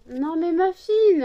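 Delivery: second, stronger call after being ignored; loud call to the dog. Real, not acted. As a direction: call the dog firmly, drawing it out. Calling the dog, insistent.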